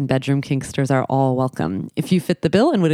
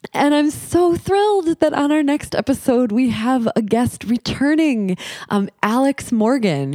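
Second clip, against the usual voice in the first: much higher, bright with excitement; thrilled; 9 semitones higher than usual. Real, not acted. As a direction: sound delighted to announce it. Thrilled announcing guest.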